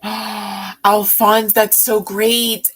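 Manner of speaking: gasp, then loud delighted praise; thrilled. Real, not acted. Streamer hears a viewer's good news, thrilled. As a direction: burst out with real delight for them.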